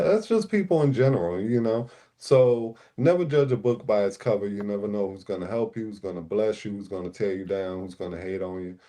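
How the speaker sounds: usual speaking voice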